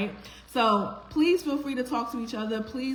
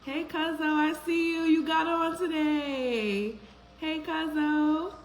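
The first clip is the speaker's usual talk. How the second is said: high, drawn-out hype greeting to a friend; excited call-out; 5 semitones higher, slower than usual. Real, not acted. Host hyping up her cousin joining the live, excited slow call. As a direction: call out to your cousin like you spotted him across the room. Calling out to arriving viewer.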